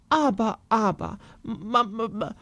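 stuttering, lost for words; acted speechless spluttering; flustered stammer. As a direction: stammer helplessly like a dismayed mother. Flustered mother at a loss.

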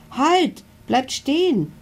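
high, pleading call to the dinos. A narrator's reading. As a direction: beg them to stop, high and urgent. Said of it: "bittet Milo". (hoch und flehend rufen)

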